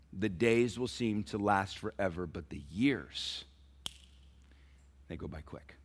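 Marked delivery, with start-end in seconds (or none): 2.3-5.8 slowed, lower and softer; slow emphatic. Shown as a demonstration. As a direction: draw out the years, then drop away. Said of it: "parents, please listen".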